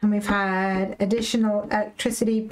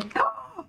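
sharp high gasp of delight; excited gasp; 4 dB quieter than usual. Real, not acted. Telling viewers the good news, high excited gasp. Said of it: "I'm really excited".

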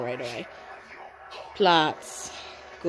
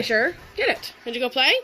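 ordinary talk

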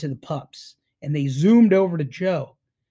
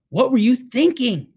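exasperated, louder and higher; a touch of exasperation in a higher, louder voice; 6 semitones higher, 5 dB louder than usual. A narrator's reading. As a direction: let the frustration burst out at him. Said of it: "Matt suddenly sounded exasperated".

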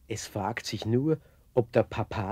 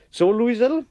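cheerful call; 12 semitones higher, 12 dB louder than usual. Acted. Painter starts sketching the girl.

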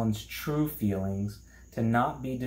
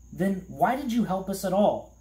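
angry shout; 8 semitones higher, 3 dB louder than usual. A narrator's reading. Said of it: "shouted Milo angrily".

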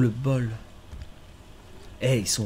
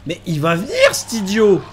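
exasperated shout; 6 semitones higher, 8 dB louder than usual. Real, not acted. Enemy about to find him.

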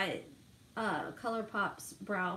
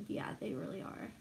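whispering; 4 semitones lower, 9 dB quieter than usual. Real, not acted. Agreeing about brows.

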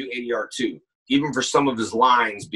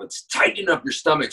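ordinary talk, nothing marked

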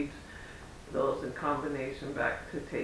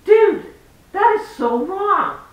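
much higher, much louder, slow; indignant outburst; 14 semitones higher, 13 dB louder, slower than usual. Retold or imitated. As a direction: scold him with full outrage. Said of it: "And I'm like".

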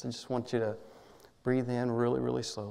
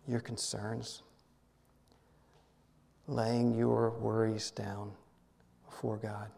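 guided meditation; 3 semitones lower than usual. Acted.

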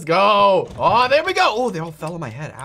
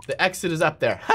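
ordinary talk, nothing marked

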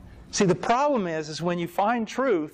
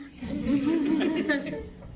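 laugh; 12 semitones higher than usual. Real, not acted. After joke about hunting back.

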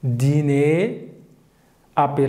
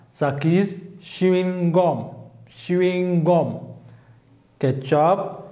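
normal talking voice

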